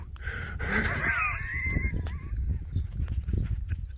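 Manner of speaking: high shrieking laugh. Real, not acted. Laughing at own impression.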